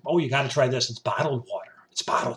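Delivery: hushed, slowing gushing water fan; a hint of a breathless water connoisseur; mock water snob. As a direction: gush over water like a snob. Mocking bottled water fans.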